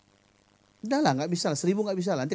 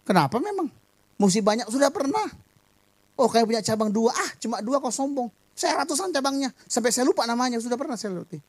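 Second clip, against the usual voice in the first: high, mocking boastful pastor voice; mock bragging about branches; 9 semitones higher than usual. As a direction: brag much higher, like a showy pastor. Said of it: "orang bilang".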